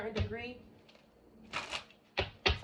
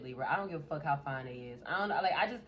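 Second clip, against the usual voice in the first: ordinary talk, nothing marked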